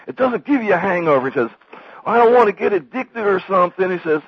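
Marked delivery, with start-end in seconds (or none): 0.0-1.3 high, loud mock insistence; Sam's retort. Sam answers Dewey's worries about reefer, high loud insistence. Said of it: "and then Sam goes". 2.0-3.9 high, loud naive southerner; Dewey Cox, very naive. Retold or imitated. Dewey worries about reefer's effects, very naive southern voice. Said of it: "like, very naive".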